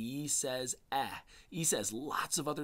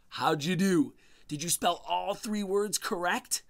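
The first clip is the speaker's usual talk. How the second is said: higher and louder, eager; excited praise; 7 semitones higher, 6 dB louder than usual. Real, not acted. Teacher eagerly asking children about their spelling, higher and louder. Teacher cheering pupils' success.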